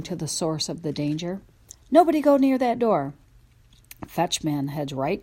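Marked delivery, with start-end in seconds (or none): 1.9-3.1 high, loud command; loud warning. A narrator's reading. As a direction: order everyone back, loudly. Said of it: "I bark".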